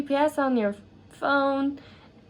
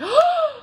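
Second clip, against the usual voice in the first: high, theatrical gasp; dramatic gasp; 4 dB louder than usual. Acted. Narrator reacting to her own plot line, dramatic gasp. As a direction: gasp in mock astonishment. Reacting to the new city news.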